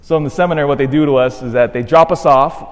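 usual speaking voice

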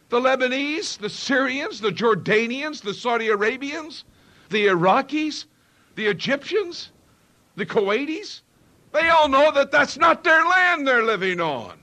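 fiery preacher hammering the point home; preaching at full force. Acted. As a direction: build the list up to a pulpit shout. Preacher builds to a shout.

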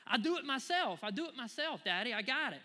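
lightly voiced insistent little girl; stubborn toddler. Daughter refusing dad's help, mild stubborn child voice. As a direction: insist like a headstrong three-year-old.

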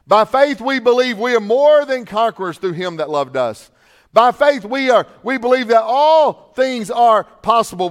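high, loud preaching, building to a shout; pastor's rising shout of faith; full-force preaching. Acted. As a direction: thunder each "by faith" at the congregation.

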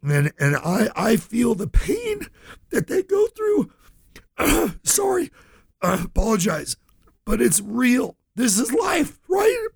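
high, tearful voice breaking into sobs; real tears, pushing the words out through crying. Real, not acted. Radio host tells listeners he cares for clients, crying. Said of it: "I get emotional saying this".

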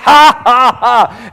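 staged "ha, ha, ha" with a punchy first ha; God's scornful laugh. Acted. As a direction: laugh in the devil's face. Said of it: "And God is laughing".

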